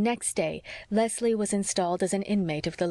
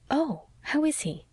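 eager, slower question; interested; 8 semitones higher, slower than usual. A narrator's reading. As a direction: lean in with real interest. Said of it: "asked Anne interestedly".

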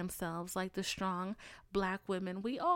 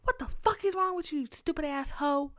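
high, loud exasperated rant; mock-furious rant at her own brain; scolding own brain; 7 semitones higher, 5 dB louder than usual. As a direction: yell at your brain in disbelief. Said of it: "I'm like".

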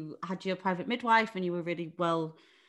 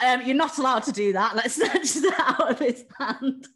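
laughing through the quote; 6 semitones higher, 7 dB louder than usual. Retold or imitated. Telling friend about midwife scolding Leon, laughing.

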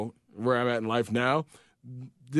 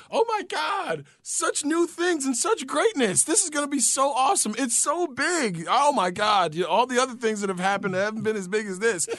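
much higher, breathless over-the-top gushing; mock cheerleader gush, pitched way up; gushing hype voice; 8 semitones higher than usual. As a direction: imitate someone hyping you up way too much. Mimics gushing hype person.

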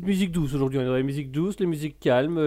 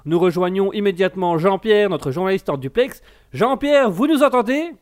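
higher, louder TV anchor voice; news anchor; 7 semitones higher, 5 dB louder than usual. Pretend. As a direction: present it like an evening news anchor. Announces the news.

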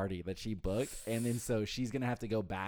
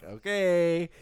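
resigned okay; 7 semitones higher, 8 dB louder than usual. Retold or imitated.

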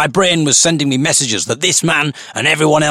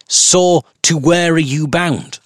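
high, slow posh lilt; sing-song posh accent; 6 semitones higher, slower than usual. Acted. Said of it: "a weird sing-song posh accent".

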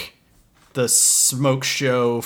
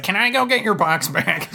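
higher-pitched eager sidekick voice; comic sidekick; 7 semitones higher than usual. Acted. Sidekick offers to fetch the box, comic eagerness. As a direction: pipe up like a cartoon sidekick.

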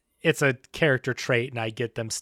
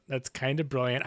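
ordinary talk

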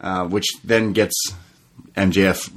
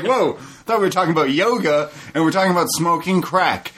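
high, fast, astonished; higher playful whoa of disbelief; mock shock; 8 semitones higher, faster than usual. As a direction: act floored by the turn. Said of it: "I was like".

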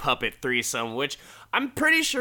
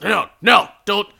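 higher, loud protective snap; sharp warning; 8 semitones higher, 9 dB louder, faster than usual. Retold or imitated. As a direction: bark him away from the case. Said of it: "Don Rickles just".

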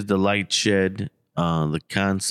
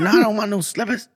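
high, quick mock old-man refusal; grumpy old man; 12 semitones higher, 3 dB louder, faster than usual. Old man refusing slippers from helpers, grumpy. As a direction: imitate a cranky old man refusing help. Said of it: "this old man is now grumpy".

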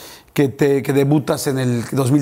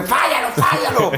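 mock heckling shout; 9 semitones higher than usual. Fan heckling a running player, mock shouting. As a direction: imitate a jeering fan from the stands. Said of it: "has escuchado alguna vez así que vayas corriendo".

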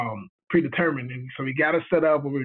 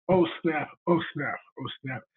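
retold dawning dread; 3 semitones lower, 6 dB quieter than usual. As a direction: mutter it, fading to very quiet.

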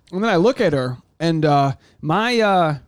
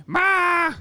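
much higher, whiny cry for mom; childish wail. Retold or imitated. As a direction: wail like a kid calling its mother. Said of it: "It's always".

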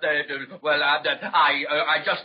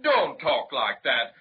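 ordinary delivery

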